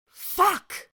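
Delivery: high, loud outburst; furious shout. Acted. Sirius swearing at his brother, loud furious outburst. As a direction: explode in anger.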